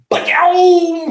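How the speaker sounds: a high whooping "Ba-yow!" holler; sudden joyful holler; excited yell. Acted. Loud excited outburst.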